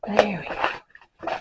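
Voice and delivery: slow and strained with effort. Real, not acted. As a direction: squeeze it out mid-struggle. Straining with canvas.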